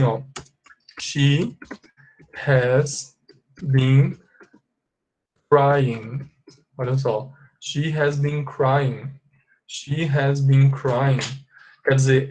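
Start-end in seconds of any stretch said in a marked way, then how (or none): 1.0-6.3 long pauses between single words; word-by-word dictation. Shown as a demonstration. Teacher translating for students, word-by-word dictation. 7.6-11.2 very slow, measured teacher's example; slow model sentence. Shown as a demonstration. Teacher repeating the translation to students, slow model sentence.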